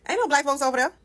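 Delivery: high-pitched mock surprise; joking, pitched well up; joking remark. Shown as a demonstration. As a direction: toss it out as a high, joking quip. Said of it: "saying in a joking manner".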